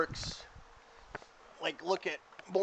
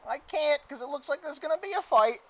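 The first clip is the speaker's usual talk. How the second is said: very high, reluctant cartoon helper; Mister Meeseeks; 10 semitones higher, 3 dB louder, faster than usual. Game master as Meeseeks refusing the player's order, high Meeseeks voice. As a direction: plead in a high-pitched character voice. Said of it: "He summons another Meeseeks".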